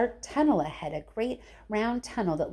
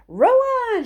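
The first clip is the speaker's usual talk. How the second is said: loud high call to the rowers; shout; 10 dB louder than usual. A narrator's reading. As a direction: bellow it like a captain. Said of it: "shouted Mister Wonka".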